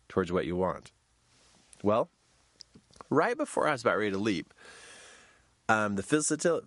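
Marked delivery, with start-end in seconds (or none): none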